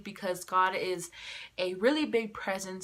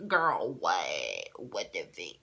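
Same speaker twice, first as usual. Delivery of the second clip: mock disbelief; much slower than usual. Seeing the faint line on the test, low mock-stunned reaction.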